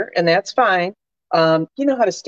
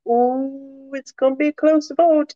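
impressed ooh; 7 semitones higher than usual. Retold or imitated. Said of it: "I was like".

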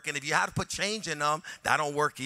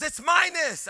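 sharp high alarm, a bit louder; blurting the alarm over the dashboard; urgent warning; 13 semitones higher, 8 dB louder, slower than usual. Retold or imitated. Wife warning her husband about tire pressure, high and urgent. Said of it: "and she goes".

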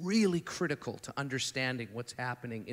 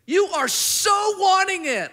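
much higher, louder, slowed cry; fervent shout; 10 dB louder than usual. Retold or imitated. Jesus crying out his longing to the young man, loud and slow. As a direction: cry it out with love. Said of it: "And Jesus looks and goes".

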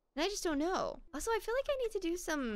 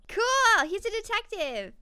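loud, high burst of excitement; excited cheer; 7 semitones higher, 6 dB louder than usual. Real, not acted. Gamer celebrating her character's new detective job, excited. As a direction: cheer it out, pitched up.

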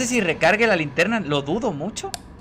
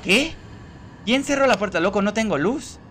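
much higher, alarmed questions; startled disbelief; 6 semitones higher than usual. Real, not acted. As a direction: react to something shocking. Door suddenly shut in dark.

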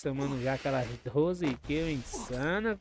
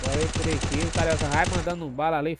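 excited; 6 dB louder, faster than usual. Real, not acted. Fast fight play-by-play.

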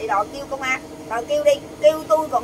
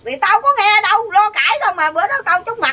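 louder and higher, arguing back hard; heated retort; 7 semitones higher, 6 dB louder than usual. Real, not acted. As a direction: push back loudly in the quarrel. Heated family quarrel over photo.

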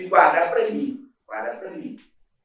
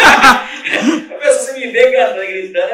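loud laughing speech, higher; laughing while talking; 6 semitones higher, 13 dB louder, slower than usual. Real, not acted. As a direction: talk through a big laugh. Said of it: "explains singing and shouting". (falando rindo alto)

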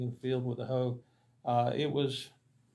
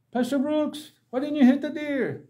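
high, loud, incredulous protest; dismayed villagers; 12 semitones higher, 8 dB louder than usual. Retold or imitated. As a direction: cry out in disbelief at the waste. Villagers scold pastor over deer.

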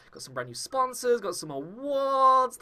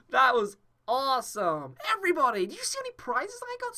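ordinary talk, nothing marked

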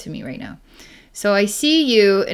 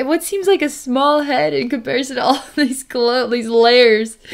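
higher voice breaking into a laugh; amused laughing; 4 semitones higher, 3 dB louder than usual. Real, not acted. Psychic describing client's outlandish clothes, laughing. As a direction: describe it while trying not to laugh.

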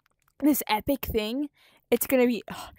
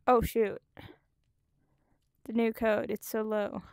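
usual speaking voice

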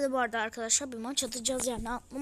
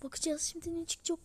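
ordinary talk, nothing marked

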